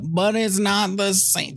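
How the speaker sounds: high, slow drawn-out whine; whiny mock complaint. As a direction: drag it out like a sulking kid. Mocking whiny remake critics.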